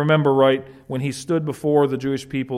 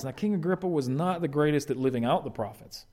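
ordinary delivery

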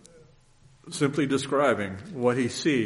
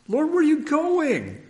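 high, loud pleading question; alarmed Peter; 6 dB louder than usual. Acted. Peter alarmed, asking Jesus where he goes, loud high question. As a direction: cry out the question much higher and louder.

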